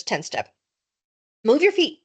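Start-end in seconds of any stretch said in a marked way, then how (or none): 1.4-2.0 louder, bossy demand; bossy order. A narrator's reading. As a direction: snap the order at him. Scott orders Evan around.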